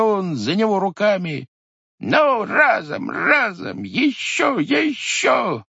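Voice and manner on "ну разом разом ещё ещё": straining effort. Acted. Grandfather hauling up the heavy pot, straining. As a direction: heave with all his strength.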